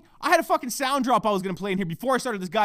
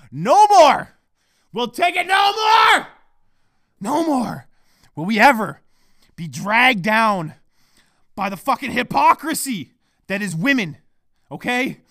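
loud, declaiming revolutionary speech; mock rallying cry; 8 dB louder, slower than usual. Podcaster calling fellas to revolution, mock rallying shout. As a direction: shout it to the masses, spacing each phrase.

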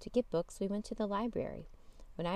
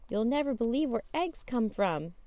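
put-on excited child; 5 semitones higher, 6 dB louder than usual. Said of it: "said Jane".

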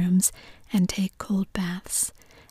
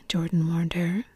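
ordinary delivery

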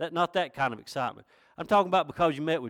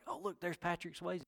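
imitating starstruck women in a hush; soft, raised mock-swooning whisper; hushed excitement; 4 semitones higher, 11 dB quieter than usual. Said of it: "They g-, got excited".

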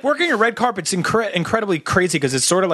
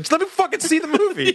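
annoyed quote; 10 semitones higher than usual. Retold or imitated. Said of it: "He's like".

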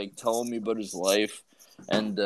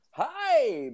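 a much higher, eager hi back; too cheery greeting to a toddler; overly enthusiastic hi. Retold or imitated. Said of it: "I was a little bit too enthusiastic".

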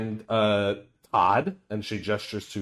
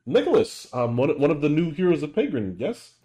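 higher, formal knight voice; Jeanette's voice; 6 semitones higher, 4 dB louder than usual. Acted. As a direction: pitch up and speak formally. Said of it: "Jeanette just goes like".

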